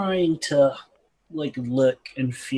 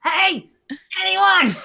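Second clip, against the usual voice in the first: high, raised call; 6 dB louder, slower than usual. Acted. Prisoner calling from the other pit, high raised call. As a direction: call out to whoever might hear. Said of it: "Like, calling from the other pit".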